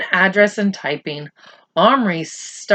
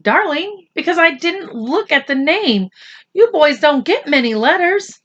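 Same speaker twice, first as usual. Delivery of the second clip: higher, soothing mother; a mother talking to her son, higher; lightly voiced mother; 7 semitones higher than usual. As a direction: explain it away gently.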